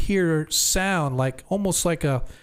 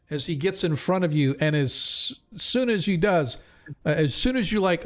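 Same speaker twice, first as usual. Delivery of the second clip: ordinary talk, nothing marked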